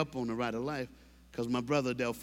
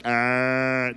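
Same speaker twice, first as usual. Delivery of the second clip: loud ugly mock wail; ugly man cry; 2 semitones lower, 5 dB louder than usual. A man's ugly cry, loud mock wail. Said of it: "the ugliest thing you've ever seen".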